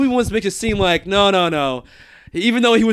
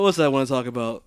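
ordinary talk, nothing marked

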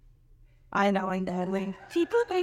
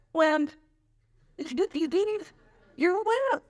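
daughter's mock jab; 8 semitones higher, slower than usual. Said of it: "she goes".

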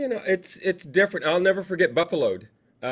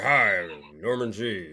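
very low, slow growled greeting; hammed-up deep villain growl, drawn out; scary guy in character; 8 semitones lower, slower than usual. Shown as a demonstration. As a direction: introduce yourself as a menacing heavy. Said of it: "walk in the door kinda like".

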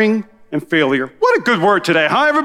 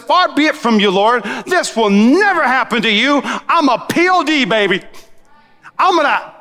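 pitched higher, insistent protest to his Lord; Peter rebuking Jesus; 5 semitones higher than usual. Retold or imitated. Peter scolding Jesus, higher and protesting. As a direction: Peter boldly pulling Jesus aside. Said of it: "Peter took him aside and rebuked Jesus".

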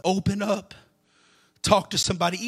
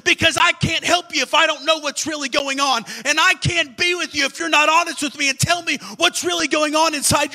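fervent preaching; 6 semitones higher, 4 dB louder than usual. Acted. Preacher at full force.

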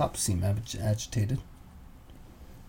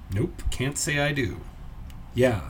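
usual speaking voice

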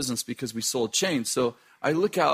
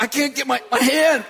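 mock panic; 8 semitones higher than usual. Retold panic, dragged by bull.

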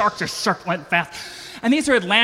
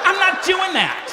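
high refusal; 10 semitones higher than usual. Real, not acted. Refusing to poke sharks.

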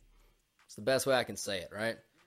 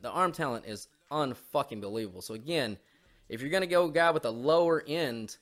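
ordinary talk

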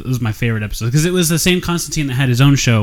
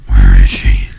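Batman growl; 6 dB louder, slower than usual. Pretend. Batman Martha scene.

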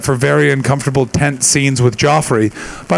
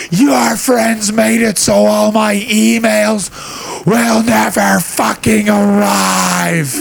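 very slow, much higher furious yell; mom yelling; 8 semitones higher, much slower than usual. Retold or imitated. Mom raging on the porch about broken Wi-Fi, slow furious yell. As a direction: drag out every furious word. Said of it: "the biggest my mom ever yelled was".

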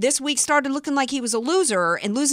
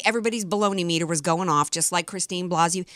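normal talking voice

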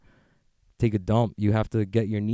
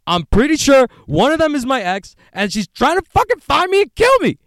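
paranoid rant; 6 semitones higher, 5 dB louder than usual. Acted. Paranoid rant against tracing apps.

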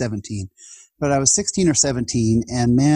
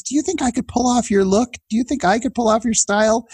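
much higher, mock-earnest questions; high playful teasing questions; imitated speaker asking playfully; 11 semitones higher than usual. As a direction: ask it high as if truly hoping to copy the look. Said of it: "He goes".

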